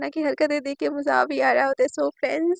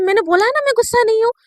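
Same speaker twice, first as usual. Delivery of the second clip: loud, fast, higher-pitched irritation; snappish denial; 6 semitones higher, 8 dB louder, faster than usual. Acted. Shivani answering Naina's question about being angry, snappish denial. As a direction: insist angrily that there is no anger. Shivani झल्लाकर गुस्सा नकारती.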